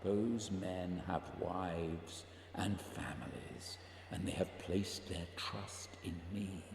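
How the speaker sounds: soft, unvoiced whisper; whispered confession. [name] confessing to Barry he thinks of death, whispered. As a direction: breathe it out as a secret.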